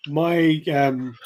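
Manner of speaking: normal talking voice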